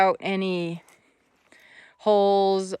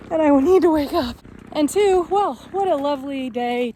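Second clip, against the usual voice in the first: excited; 7 semitones higher, 6 dB louder than usual. Real, not acted. Excited walking talk.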